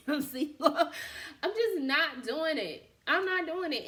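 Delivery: laughing out loud while insisting. Real, not acted. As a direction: laugh through it, amused and firm. Laughs at her own story.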